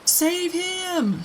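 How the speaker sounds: very high panicked scream; screaming for help. A narrator's reading. Mother screams as her son falls in, panicked. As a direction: scream for someone to save him. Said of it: "screamed Missus Gloop".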